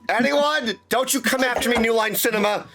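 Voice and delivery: high, hammed-up dramatic cry; performative rendition. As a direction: overact it like a movie hero. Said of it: "It's performative, expressive rendition".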